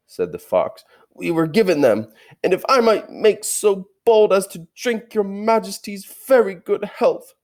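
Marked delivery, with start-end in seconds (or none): 1.1-7.4 high, ingratiating toast, slowing at the end; an old fox toasting the queen, high and uneasy; nervous old fox. Acted. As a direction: flatter her to save his skin.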